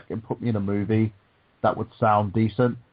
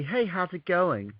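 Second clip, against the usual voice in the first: higher, slow sparkly American greeting; light, sparkly American; 6 semitones higher, slower than usual. Shown as a demonstration. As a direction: make it very light and sparkly, pitched up. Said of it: "very light, very sparkly".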